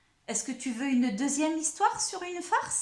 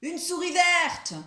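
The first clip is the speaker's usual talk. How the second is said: high, loud alarm call; crying out loudly; 6 semitones higher, 5 dB louder than usual. Acted. Wolf turning the prank round with false alarm, loud cry. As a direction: shout it high, stretching the last word.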